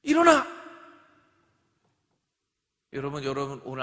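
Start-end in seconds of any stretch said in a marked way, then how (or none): 0.0-0.5 loud, high shout to wake someone. Shown as a demonstration. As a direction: yell at a sleeper to get up. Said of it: "이제 졸고 있는 거예요".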